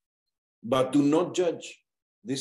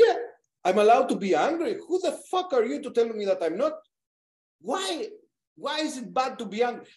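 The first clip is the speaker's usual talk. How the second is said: fast, high-pitched retold comeback; acted-out indignant protest; indignant retort; 9 semitones higher, much faster than usual. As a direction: fire back as if defending your right to anger. Retold argument, defends being angry.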